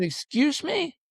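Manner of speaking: high, affronted question; mock-indignant high query; offended disbelief. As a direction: shoot up in pitch, insulted. Offended reply to accountant, retold.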